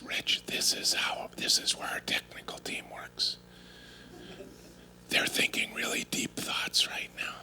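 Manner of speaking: hushed tour-guide whisper; a reverent, breathy library whisper. Retold or imitated. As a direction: whisper as if not to disturb anyone. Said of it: "so I'm not sure why we're whispering".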